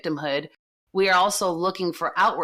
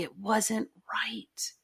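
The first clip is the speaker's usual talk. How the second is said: whisper; 4 semitones higher, 7 dB quieter, much slower than usual. Real, not acted. Stressing the hurt was wrong.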